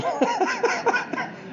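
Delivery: a high airy burst of laughter; breathy high laugh. Real, not acted. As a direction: laugh it off, falling away. Laugh after court story.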